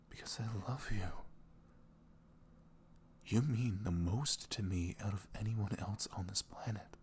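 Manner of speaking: soft, tender whisper; ASMR whisper. Acted. Boyfriend whispering his love to his girlfriend, tender. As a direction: reassure her gently, close to her ear.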